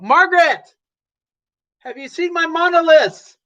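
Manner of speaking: calling out to wife. Acted. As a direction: shout the question across the house. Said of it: "call out to the female".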